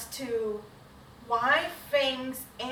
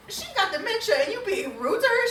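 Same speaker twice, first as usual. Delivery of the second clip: high, raised voice with laughter; laughing indignation; 9 semitones higher, 4 dB louder than usual. Real, not acted. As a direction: protest loudly, half laughing. Indignant on Nana's behalf.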